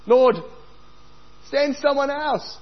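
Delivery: high, slow plea "send someone else"; Moses begging. Acted. Moses begging God to send someone else. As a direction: beg the Lord to send another.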